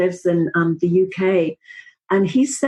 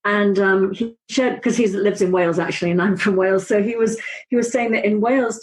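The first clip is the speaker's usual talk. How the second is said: ordinary delivery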